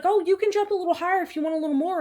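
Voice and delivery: high, fast chirpy instructor. Retold or imitated. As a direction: imitate a bubbly trainer. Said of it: "she was like".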